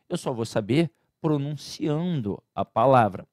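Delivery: ordinary talk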